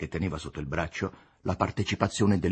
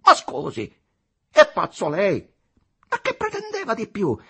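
high, loud acted indignation; indignant protest; 10 dB louder than usual. A narrator's reading. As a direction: protest as if he were crazy. (protesta indignata, a voce alta)

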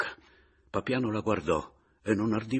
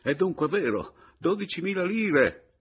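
high-pitched, rising agitated exclamation; distraught cry; 11 semitones higher, 5 dB louder than usual. A narrator's reading. Caporale rushing up to the narrator, distraught. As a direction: cry it out, deeply upset. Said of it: "Mi venne incontro tutta sconvolta".

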